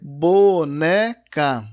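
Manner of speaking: "boneca" slow and loud, bo, ne, ca; syllable by syllable. Shown as a demonstration. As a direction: break "boneca" into three drawn-out syllables. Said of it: "quando você separar".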